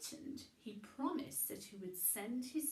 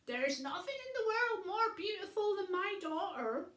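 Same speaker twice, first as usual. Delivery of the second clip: high, loud proud boast; proud old man calling; 9 semitones higher, 7 dB louder than usual. Acted. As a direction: call it back as you ride away. Old man calling while riding off.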